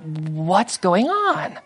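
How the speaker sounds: slow, hushed bewilderment; puzzled crowd. Acted. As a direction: wonder it softly, drawing the words out. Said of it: "crowd kind of scratching their head".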